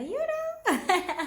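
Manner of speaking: high laugh rising into giggles. Real, not acted. Vlogger laughing at her own joke, high and giggly. Laughing after quoting herself.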